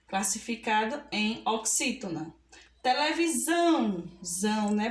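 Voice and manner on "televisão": "Televisão" with a long, strong final são; teacher's demo of "Televisão", weight on the end; stressed last syllable. Shown as a demonstration. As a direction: lean hard on the são of "Televisão". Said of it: "Pronunciamos com mais força".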